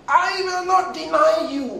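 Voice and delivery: high, booming boast as Peter; very loud declaration. Acted. Preacher acting out Peter's boast, very loud declaration. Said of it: "he must have said it very loudly".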